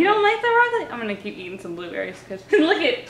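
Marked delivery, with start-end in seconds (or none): 0.0-0.9 high sing-song question to a dog; dog-talk voice. Real, not acted. Talking to dog refusing food.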